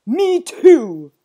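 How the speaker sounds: loud, high, emphatic; booming agreement. A narrator's reading. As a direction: boom it out. Owner agrees heartily.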